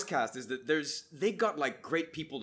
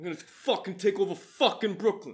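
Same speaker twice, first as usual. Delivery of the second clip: mock New York accent; 3 semitones higher, 6 dB louder than usual. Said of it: "Joe Rogan's just like".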